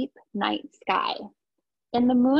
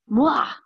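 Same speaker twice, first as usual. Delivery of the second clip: kiss sound; 3 semitones higher, 5 dB louder than usual. Acted. Reader acts out the kiss.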